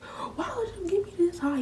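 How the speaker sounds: high, loud mock wail; hammed-up crying complaint; acted-out crying. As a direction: whine it tearfully, far up in pitch. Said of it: "And I'm crying, y'all. I'm crying".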